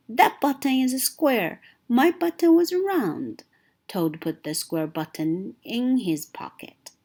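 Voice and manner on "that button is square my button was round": wailing. Acted. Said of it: "wailed Toad".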